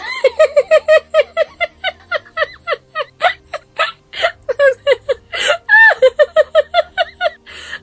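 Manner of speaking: high, loud, uncontrollable laughing fit; real high-pitched cackling laughter; long laugh. Real, not acted. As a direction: crack up helplessly, laugh on and on. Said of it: "I'm laughing".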